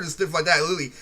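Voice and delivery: usual speaking voice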